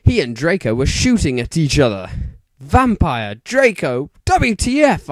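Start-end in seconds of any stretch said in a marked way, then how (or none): 2.6-5.1 surprised. A narrator's reading. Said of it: "I asked".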